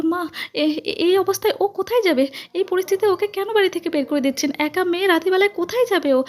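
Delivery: high-pitched, stammering start; desperate pleading. A narrator's reading. Daughter-in-law begging mother-in-law for mercy, pleading. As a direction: beg urgently in a high, anxious voice.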